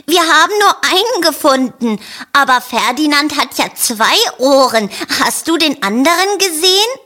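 high, eager sheep voice, quick at the end; Charlotte sheep voice. A narrator's reading. Sheep telling Rosa about the missing one, high and eager.

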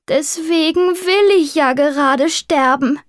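high, melodramatic tomcat voice; high-pitched, theatrical woe; tragic. A narrator's reading. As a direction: lament it like a doomed tragic hero. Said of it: "versetzte Maurizio mit tragischer Miene".

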